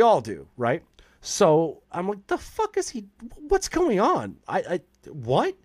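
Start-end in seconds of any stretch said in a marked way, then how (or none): 2.3-4.4 pitched way up in baffled alarm, half mocking himself. Retelling to the host his panic at kitchen noise, high baffled squeak. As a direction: squeak it out like you can't believe the racket. Stoned dad banging in kitchen.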